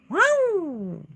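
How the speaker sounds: high yelping dog cries; high mock dog yowls; imitated dog yelp. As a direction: yelp like a dog trapped somewhere. Imitating the dog sound heard.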